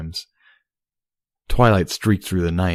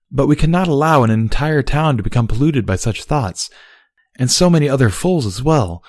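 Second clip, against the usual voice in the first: Luna arguing; 6 semitones higher, 4 dB louder than usual. A narrator's reading. Luna voiced in story.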